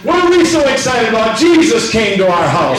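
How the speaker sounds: rousing rhetorical question; excited preaching. Real, not acted. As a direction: exclaim it high, then land firmly. Preacher exclaims to congregation.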